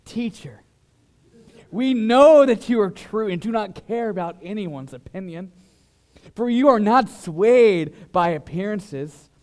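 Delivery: oily flatterer laying it on thick; fawning flattery. Acted. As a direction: sweet-talk the teacher like you want something. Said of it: "read it in the tone I'm convinced it ought to be read in".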